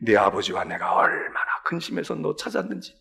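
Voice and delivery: usual speaking voice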